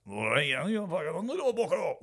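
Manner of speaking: put-on shifting Scottish accent. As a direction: let the Scottish slide up in pitch. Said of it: "the accent changing from".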